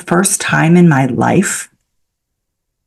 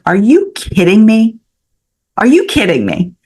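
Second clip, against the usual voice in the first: high-pitched, astonished disbelief; incredulous; 6 semitones higher than usual. Retold or imitated. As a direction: react as if it's too good to be true. Retold reaction to a lucky coincidence.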